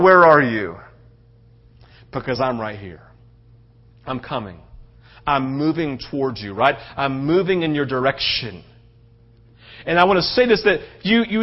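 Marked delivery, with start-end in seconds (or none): 0.0-8.6 low, soft, intimate voice of God; God's tender call. Acted. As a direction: draw them near gently, almost confiding. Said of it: "He's crying out".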